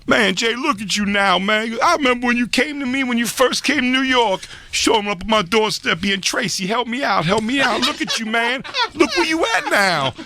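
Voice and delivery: high, slow reminiscing Tracy; Tracy Morgan impression. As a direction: gush like a proud mentor. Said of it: "he was like".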